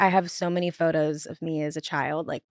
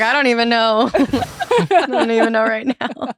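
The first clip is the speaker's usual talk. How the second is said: laughing tipsy Southern; 4 semitones higher, 5 dB louder than usual. Retold or imitated. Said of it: "I'm like".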